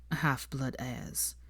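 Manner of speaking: quiet, slow bitter quote. A narrator's reading. Draco repeats his mother's slur to Hermione, slow and quiet. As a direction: let the words hang with distaste. Quoting mother's disdainful words.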